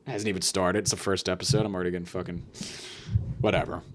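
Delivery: ordinary talk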